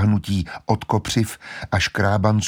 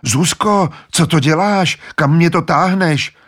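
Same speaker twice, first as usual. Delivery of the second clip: high, louder alarmed cry; Peter cries out; 8 semitones higher, 5 dB louder than usual. Acted. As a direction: shout at his sister in alarm. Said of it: "Místo toho zvolal". (Petr vyděšeně volá na sestru)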